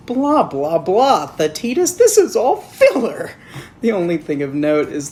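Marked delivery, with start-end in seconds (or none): 0.1-3.3 pompous mock quote rising to loud; mock philosopher. Reviewer quotes Plato mockingly, rising and louder. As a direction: orate it grandly then rise. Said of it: "in the words of the great philosopher Plato".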